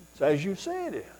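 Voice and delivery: softly understated reply; mild, unforceful answer. Acted. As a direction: answer simply and softly, without force. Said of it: "It wasn't strong or forceful".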